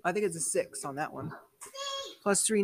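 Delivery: normal talking voice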